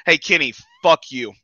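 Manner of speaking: loud wrestling heel promo. Shown as a demonstration. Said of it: "Get into character real quick for you guys".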